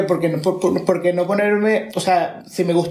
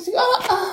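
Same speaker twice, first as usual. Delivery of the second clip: mock birth screams; faster than usual. Joking about giving birth.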